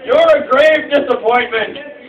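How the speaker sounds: loud, high, slow proclamation; pompous ancestral rebuke; scolding ancestor. Acted. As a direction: declare it like an outraged ghostly ancestor. Ancestors scolding in retold story.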